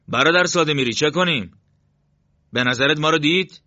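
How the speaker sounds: high, loud anxious questioning; worried comrade. A narrator's reading. Comrade fears they were spotted.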